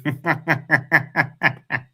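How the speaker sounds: giggling nervously; a short nervous laugh. Real, not acted.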